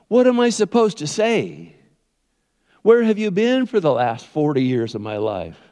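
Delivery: pleading, complaining questions; whining Moses. Acted. Preacher as Moses questioning God, whining complaint. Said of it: "he's whining and he's complaining".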